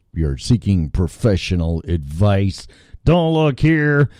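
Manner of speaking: slow, emphatic announcer delivery; show disclaimer read. Acted. Host warning chat room guests, mock-official disclaimer. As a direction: read it out slowly and deliberately, word by word.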